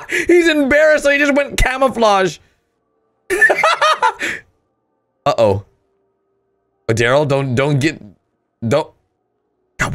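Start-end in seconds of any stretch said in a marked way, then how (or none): 3.3-4.4 high falling laugh. Real, not acted. Viewer cracking up as the frog hides, high laugh. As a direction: let a long laugh fall away. Frog turns camouflage from embarrassment.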